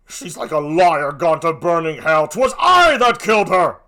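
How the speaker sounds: slow, loud roar; furious confession. Acted. Othello confesses to Emilia that he killed her, furious.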